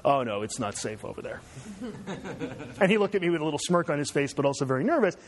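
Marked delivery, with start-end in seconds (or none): 0.0-1.4 nervous laugh through the words; half-laughing, nervously refusing; imitating a nervous dad with a laugh. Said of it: "He said". 1.5-2.8 quiet amused chuckle; a soft breath of a laugh. Real, not acted. Laughs at dad story.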